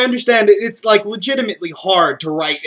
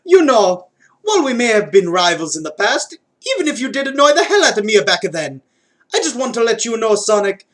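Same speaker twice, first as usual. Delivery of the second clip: higher, hammed-up Mario-style Italian accent; Mario's accent, pitched up and earnest; 6 semitones higher than usual. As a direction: play it as a heartfelt Mario with his accent. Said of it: "keeping the accent for Mario".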